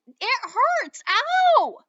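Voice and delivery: high loud yelp of pain; yelling. A narrator's reading. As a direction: yell out from the sting. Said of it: "Patrick kept yelling".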